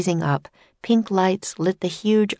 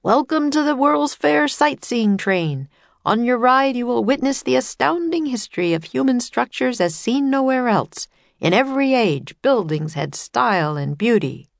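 tour guide announcer; 6 semitones higher than usual. Acted. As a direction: announce it through a megaphone. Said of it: "voice blared from a megaphone".

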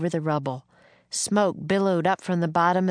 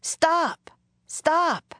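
much higher calling out, moderate volume; urgent cry; 12 semitones higher than usual. A narrator's reading. Jack calls after the wagon, urgent high cry. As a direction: call after someone to halt, pitched way up. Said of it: "cried Jack".

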